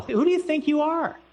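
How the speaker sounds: very high-pitched acted outrage; mock scandalized questioner; incredulous demand. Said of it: "They wanna know".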